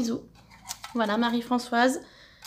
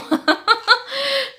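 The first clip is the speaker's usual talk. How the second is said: bright, high burst of laughter; a real giggle about being a glutton; 10 semitones higher, 3 dB louder than usual. Real, not acted. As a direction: laugh at yourself for being greedy. Laughs at her own greed.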